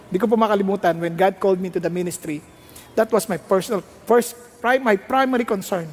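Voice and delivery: normal talking voice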